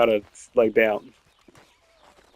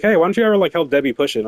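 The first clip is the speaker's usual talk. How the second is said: high, louder needling ask from a coworker; mock coworker question; 6 semitones higher, 5 dB louder, faster than usual. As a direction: toss the question up high and pointed. CNA's question retold.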